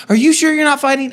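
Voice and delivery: put-on high pestering kid voice, rushed. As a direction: push the kid's voice up high and insistent. Daughters asking again from the back.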